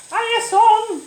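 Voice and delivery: loud, high excited hail; high, shouted hiya; calling out a greeting. Real, not acted. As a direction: holler hello to a relative. Calling out to cousin who joined.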